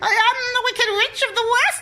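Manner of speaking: high, loud witch voice with laughter; Wicked Witch voice. Acted. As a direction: cackle it out as the witch. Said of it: "my evil, evil witch laugh".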